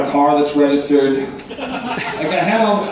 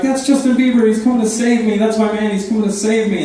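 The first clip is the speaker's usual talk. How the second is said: higher, excited fan gushing; mock excited gush; 5 semitones higher than usual. Delusional patient sees a plane, excited gush. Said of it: "she'd be like".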